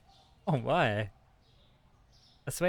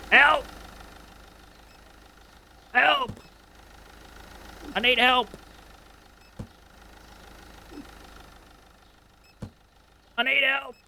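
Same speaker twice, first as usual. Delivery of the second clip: much higher, much louder hammed-up yelling; mock cry for help; 13 semitones higher, 6 dB louder than usual. Swamped shop owner calling out for help, mock panicked yell. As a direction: wail for help like you're drowning in work.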